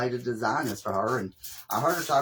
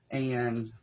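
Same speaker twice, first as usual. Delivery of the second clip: ordinary talk, nothing marked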